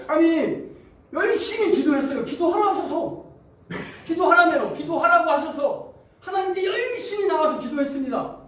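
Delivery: high, loud self-justifying complaint; indignant protest. Acted. Believer who prayed as told protesting to God, indignant. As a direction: insist hotly that you did as told. Acting an aggrieved praying believer.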